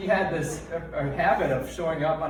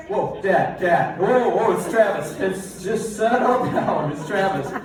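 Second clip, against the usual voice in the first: higher, louder urgent pleading; calm him down in a hurry; mock frantic calming; 5 semitones higher, 7 dB louder than usual. Travis calming startled dad.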